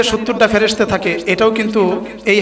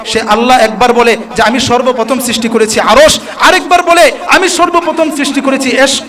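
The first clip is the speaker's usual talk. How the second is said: preaching at full force; 4 semitones higher than usual. Acted. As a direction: drive it home to the crowd with fire. Fiery sermon run.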